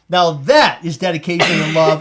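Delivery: normal talking voice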